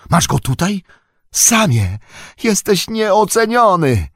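very high, loud, overjoyed amazement; astonished cry. A narrator's reading. Frodo praises Sam on finding the Ring safe, astonished cry. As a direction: cry out amazed, stretching the last words high. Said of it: "zawołał zdumiony Frodo".

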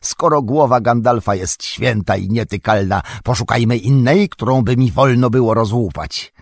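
a dwarf hollering a rowdy joke; boisterous shouting. A narrator's reading. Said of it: "wołał, wymachując toporkiem".

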